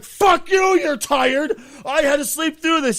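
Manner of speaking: high, indignant shouting; mock outrage. Snapping at the friend who slept through it, indignant yelling. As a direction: act out an exasperated yell. Acted angry retort to rested friend.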